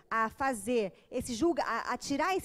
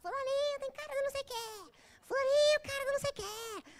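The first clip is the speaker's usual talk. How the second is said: high, sing-song mocking voice; girl mocks each classmate who walks in, high and taunting; 13 semitones higher than usual. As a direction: tease each newcomer in a high sneer. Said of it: "ela falava".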